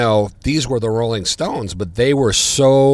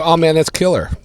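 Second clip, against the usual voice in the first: loud, high, excited answer; excited reply; 6 semitones higher, 7 dB louder than usual. Retold or imitated. Friend at the gig naming the drummer, excited. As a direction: call out the name with excitement.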